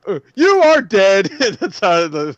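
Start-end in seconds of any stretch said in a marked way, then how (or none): 0.1-1.3 slow, dramatic spoken word; drawn-out dramatic poem reading; slam poetry delivery. Retold or imitated. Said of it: "He's just like".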